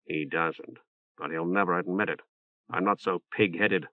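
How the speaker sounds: a sly, quiet jab, lightly voiced; Loris's dry voice. A narrator's reading.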